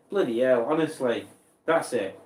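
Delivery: ordinary talk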